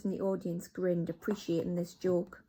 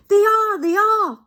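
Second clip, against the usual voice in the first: much higher, loud shrill-free chorus of agreement; chorusing witches; 14 semitones higher, 16 dB louder than usual. Acted. English witches answer their leader, high loud chorus. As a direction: agree eagerly as a crowd. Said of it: "chorused the English witches".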